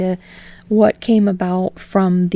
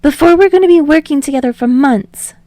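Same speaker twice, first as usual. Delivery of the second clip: higher, louder, firmer Deku; more steel; 7 semitones higher, 7 dB louder than usual. Acted. Deku insisting to Bakugo, higher with steel. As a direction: put some steel in it. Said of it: "a little more steel in his voice".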